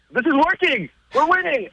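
high, breathless realization of winning; whisper-shout the sudden good news to yourself; stunned delight. Retold or imitated. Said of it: "I'm like".

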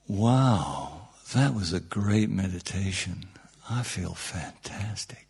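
low, soft, fading into a whisper; blissed-out mock awe. People praising a great meditation, hushed blissful mimicry. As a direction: sigh it out softly in mock rapture.

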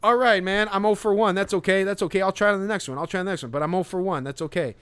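high, loud, chipper self-consoling; mock upbeat analyst. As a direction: cheer yourself up like a batter who went hitless. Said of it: "Harold Reynolds had to go like".

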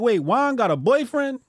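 high, loud mock disbelief; hammed-up incredulous question; mock shock. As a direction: retell your shock, loud and high-pitched. Retelling his shocked reaction to Duolingo.

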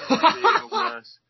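high, loud words through laughter; laughing. Real, not acted. Joking about shouty horse names with co-host, laughing. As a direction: crack up while saying it. Cracking up after own shouted joke.

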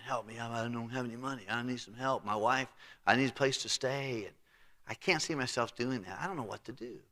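quiet and defeated, almost under the breath; a hushed, hopeless self-talk; despairing mutter. Acted. Steward despairing, picturing himself begging.

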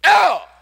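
sharp, very high shout of "oh"; startled-sounding outburst, acted out; sudden loud yelp. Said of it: "I will sometimes go".

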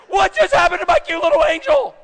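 loud, high-pitched disbelief; hammed-up shocked yell; mock-aghast parent. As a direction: cry out in comic horror at the child. Parent aghast at toddler's public tantrum.

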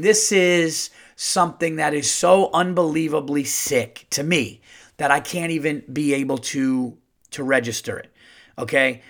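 ordinary delivery

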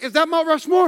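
high-pitched eager question, imitating his wife; excited wife. Wife spotting a hill on the drive, imitated excited asking. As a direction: play an excited sightseer asking hopefully. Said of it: "she was excited".

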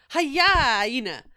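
loud drawn-out "Hyena"; loud hyena call. Shown as a demonstration. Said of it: "thinking more of the hyena's laugh".

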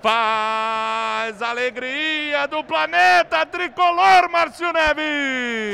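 slow, drawn-out radio call; goal call signature. Acted. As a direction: stretch it like a radio anthem. (bordão de narrador esticado)

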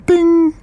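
a sharp, high "ding!" like a struck bell; ping it high like a chime; bell imitation. The bell ending the meditation, sung out high. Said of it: "all of a sudden".

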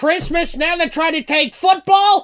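high-pitched, hammed-up tirade; put-on indignant yelling; mock outraged rant. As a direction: play up the indignation, voice way up. Mock outraged rant as film's protester.

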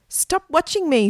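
high-pitched, offended objection; indignant protest. A narrator's reading. Egbert objecting to his sister watching, high indignant protest.

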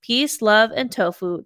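ordinary talk

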